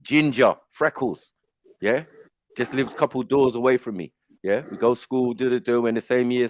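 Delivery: ordinary talk, nothing marked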